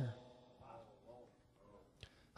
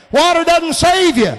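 loud shout, SAVE hit hard; preacher shouts that water saves no one; shouted point; 11 semitones higher, 8 dB louder than usual. Acted. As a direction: bellow it at the crowd.

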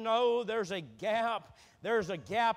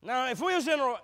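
ordinary talk, nothing marked

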